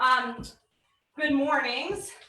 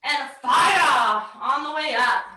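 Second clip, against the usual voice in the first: coaching shout; 3 dB louder than usual. Real, not acted. Coach pushing final rep.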